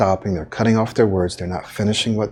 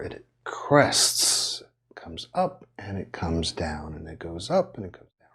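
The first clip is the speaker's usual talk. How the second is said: wave-like inflection; 7 dB quieter than usual. Shown as a demonstration. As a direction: let the voice swell and fall like a wave. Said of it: "much like an ocean wave".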